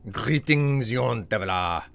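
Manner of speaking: a touch of a mock French chef, slow; chef accent. Chef voice greets a young traveler. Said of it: "The chef accent has begun".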